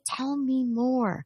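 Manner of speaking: slow, tender prompt; slow gentle invitation. Shown as a demonstration. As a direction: ease it out slowly and kindly. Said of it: "You say".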